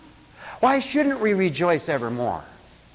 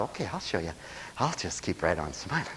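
grudging mutter; 10 semitones lower, 10 dB quieter than usual. Retold or imitated. Proud person voiced grudgingly.

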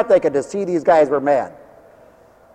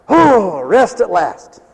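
ordinary talk

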